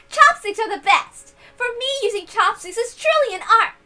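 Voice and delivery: high and loud, gushing and eager; excited chopstick fan. Acted. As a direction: rave about chopsticks at the top of your voice. Reader voices an excited character.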